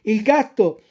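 ordinary delivery

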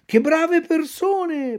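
slow, high naive puppet; inner thought. Acted. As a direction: marvel to yourself. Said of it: "pensò dentro di sé Pinocchio".

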